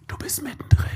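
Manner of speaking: whispered, confiding aside; hushed. Real, not acted. Preacher telling church they are right in it, whispered. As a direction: whisper it like a secret. Tells listener what goes unnoticed.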